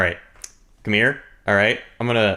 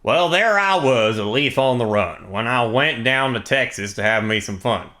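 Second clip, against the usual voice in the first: put-on Texan accent. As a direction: spin a yarn in a big Texan accent. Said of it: "do your best Texan accent".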